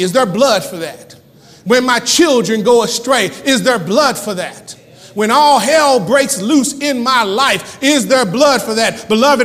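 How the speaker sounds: fervent preacher building in force; impassioned preaching. Acted. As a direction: rouse the congregation with urgent repeated questions.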